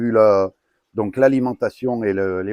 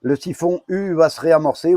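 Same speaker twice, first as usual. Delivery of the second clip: ordinary delivery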